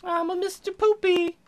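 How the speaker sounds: high squeaky cartoon poop voice; poop character voice.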